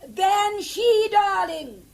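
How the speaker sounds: loud shout down a bad phone line; calling loudly. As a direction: raise the voice to be heard. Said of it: "I'm screaming like a banshee".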